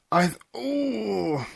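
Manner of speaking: breathy pained catch; pained gasp. A narrator's reading. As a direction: stop short in sudden pain. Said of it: "clutched her stomach".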